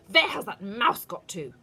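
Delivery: very loud witch demand. A narrator's reading. Witch hunting the lost mouse, loud. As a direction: bark the question.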